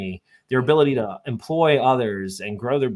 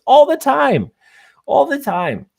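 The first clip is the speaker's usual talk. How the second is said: high and louder, eager and doting; sweet old lady; 9 dB louder than usual. Retold or imitated. Grandma telling the salesman her grandkids visit constantly, eagerly. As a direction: gush it like a proud grandma. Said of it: "sweet southern voice".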